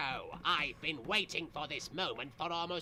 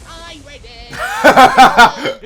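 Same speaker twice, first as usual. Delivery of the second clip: laughing outburst; 10 semitones higher, 26 dB louder, much slower than usual. Real, not acted. Laughing exclamation.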